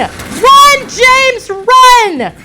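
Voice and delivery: high, loud frantic yell at the screen; panicked shout. Real, not acted. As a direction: scream at him to flee. Panicked shouting at game character.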